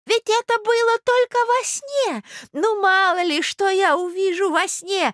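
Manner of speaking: very high, slow doddering voice; old prince, protesting. Acted. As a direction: excuse yourself plaintively as an old man. (старый князь, оправдывается высоко и протяжно)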